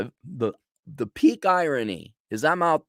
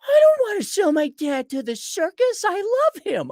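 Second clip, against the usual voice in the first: put-on high little-kid voice, pleading; imitating a five-year-old girl, high and pleading; child's voice; 3 dB louder than usual. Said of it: "She's only five".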